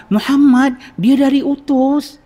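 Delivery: thrilled the awaited guest has come; excited. Retold or imitated. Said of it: "dengan nada suara excitement". (nada gembira teruja)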